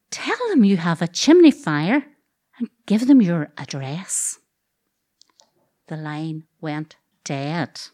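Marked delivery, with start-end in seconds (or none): 0.1-4.4 impatient brother. Retold or imitated. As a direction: talk her through it like to a child. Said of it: "I feel his impatience".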